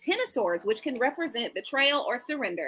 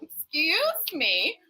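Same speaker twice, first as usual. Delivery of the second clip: drawn-out, rising mock disbelief; mock affronted; 3 semitones higher, much slower than usual. Reader answering a hateful commenter, mock affronted. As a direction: stretch it slowly, playing offended. Acted reply to the hater.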